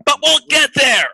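high, loud cut-off; emphatic promise. Real, not acted. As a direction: call it out to halt the hype. Breaks off own hype, voice raised.

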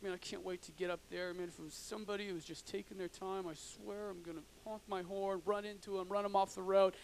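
seething under breath. Retold or imitated. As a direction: mutter the threats as if no one should hear. Driver seething quietly in traffic.

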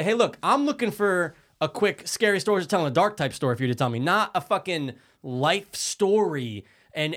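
ordinary delivery

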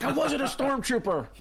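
high-pitched indignant protest, mock outrage; defensive retort. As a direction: imitate someone protesting far too quickly, voice shooting up. Acting out a defensive comeback.

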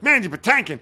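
high-pitched starstruck disbelief; excited. Retold or imitated. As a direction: blurt the name like an excited fan. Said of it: "really excited about meeting this guy".

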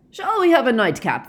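loud, high, hearty offer; Mister Weasley loud. A narrator's reading. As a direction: boom it out to change the subject. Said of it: "asked Mister Weasley loudly".

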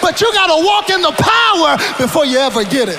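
shouted, high preaching cadence; full-force preaching. Acted. Preacher shouting to the congregation, full-force preaching.

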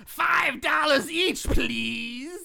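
slow, high croak of a hunchback; a slow, high, freaky hunchback voice; cracky freaky voice. Acted. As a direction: creak it out like a deformed old doorman. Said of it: "in a cracky, freaky voice".

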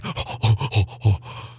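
sharp gasping breath at the end of a cry; a long retold gasp for air; gasp after crying. After weeping on the church floor, a gasping breath.

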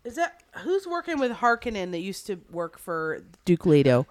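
normal talking voice

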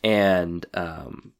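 ordinary delivery

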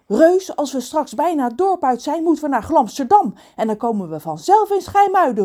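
put-on high, eager boy's call; relieved exclamation. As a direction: burst out with the answer, relieved. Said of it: "roept hij opgelucht".

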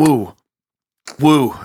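puckered woo after a sour sip; sour beer reaction. Real, not acted. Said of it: "It was sour, like, right away".

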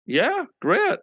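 high-pitched, enthusiastic acceptance; eager agreement. Retold or imitated. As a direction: re-enact leaping at an offer. Retells accepting German concert offer.

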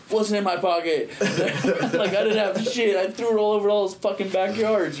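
high-pitched mock denial with laughter; protesting innocence. Suspect denying to cops it was his, high mock protest. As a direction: deny it indignantly, half laughing.

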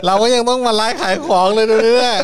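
loud hyped-up seller pitch; fast hype live seller. Pretend. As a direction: hawk it like a live stream seller. Mock live-selling for donations. (พูดเร็วเร้าใจแบบแม่ค้าไลฟ์สด)

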